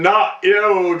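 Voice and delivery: narrator's mildly angry Joe; riled. A narrator's reading. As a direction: throw it back crossly. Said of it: "had fair raised his gorge".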